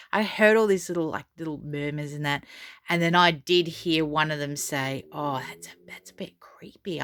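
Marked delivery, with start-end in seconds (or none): 5.1-7.0 hushed retold whisper. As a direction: breathe it like overheard gossip. Overheard diners whispering.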